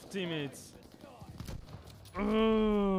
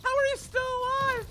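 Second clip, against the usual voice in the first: high loud how are you alive; disbelieving yell; 8 dB louder than usual. Real, not acted. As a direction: shout in disbelief. Enemy survives shots.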